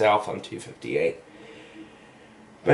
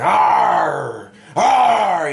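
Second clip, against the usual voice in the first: imitated roar; 6 semitones higher, 5 dB louder than usual. Masked dude roaring across the parking lot, mock roar. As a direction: roar like a masked guy trying to scare people. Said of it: "you know, like that".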